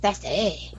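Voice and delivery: "Sassy" drawn out as "sess-AY"; "Sassy" ending in a long "-ay"; fancy pronunciation. Shown as a demonstration. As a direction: slowly stretch "Sassy" into a fancy "seh-SAY". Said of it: "probably pronounced something fancy".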